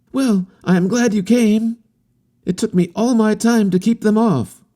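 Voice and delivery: put-on an eager young wanderer reassuring; harlequin youth. A narrator's reading. Harlequin assuring the captain about the natives, high and earnest.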